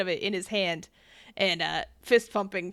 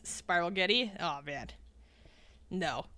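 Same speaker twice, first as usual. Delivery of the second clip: ordinary talk, nothing marked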